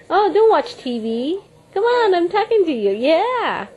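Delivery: ordinary talk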